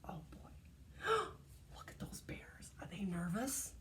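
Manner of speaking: whisper. Real, not acted. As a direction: hush it, nervous and curious. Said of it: "whispered asides".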